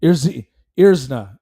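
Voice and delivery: usual speaking voice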